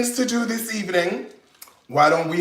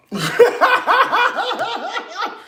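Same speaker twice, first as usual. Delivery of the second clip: high laugh. Real, not acted. Cook laughing at her own pig joke, high laugh.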